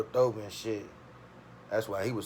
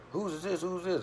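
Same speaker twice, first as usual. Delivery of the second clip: mock cop demand; 9 semitones higher than usual. Cop demanding whose stash it is.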